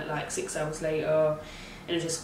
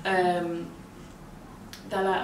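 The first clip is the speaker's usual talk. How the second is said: usual speaking voice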